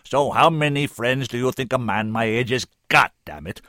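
loud, commanding oratory; full-power voice. A narrator's reading. Senator urges the woman not to give up, full-powered. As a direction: press each point home with full force. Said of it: "using the full power of his voice now".

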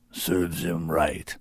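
whispered beast scorn; whispered scoff. A narrator's reading. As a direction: mutter it with contempt. Sorin scoffs.

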